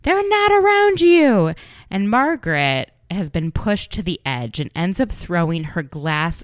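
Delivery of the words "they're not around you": high, loud yelled retort; screeching reality-TV yell; mock yell. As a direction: yell it back at her, high and hard. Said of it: "Danielle yells".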